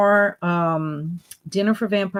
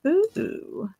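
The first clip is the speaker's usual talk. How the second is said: ordinary talk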